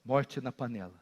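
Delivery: very low, soft and grave; quiet, sombre warning; hushed repeat. Real, not acted. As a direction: let it drop almost to nothing. Hushed repeat after the shout.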